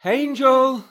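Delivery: high, piercingly loud call; shouting. Acted. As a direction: shout the name out to someone far away. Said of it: "She was shouting".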